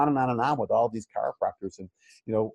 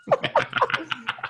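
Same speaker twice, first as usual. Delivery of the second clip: a high burst of laughter. Real, not acted. Laughing at own naivety.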